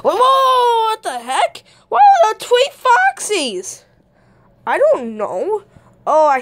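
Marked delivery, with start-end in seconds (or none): 0.0-3.8 high, drawn-out whoa of play shock; astonished. Pretend. Foxy discovers the clones, acted astonishment. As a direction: exclaim in exaggerated, high-pitched disbelief. Character finds three clones.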